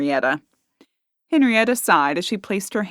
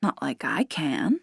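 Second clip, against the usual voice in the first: softer, slow sultry purr; sultry boast; 3 semitones lower, 7 dB quieter, slower than usual. A narrator's reading. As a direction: lure him in quietly. Barmaid seductive boast.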